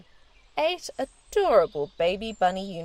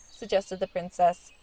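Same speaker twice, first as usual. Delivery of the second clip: ordinary delivery, nothing marked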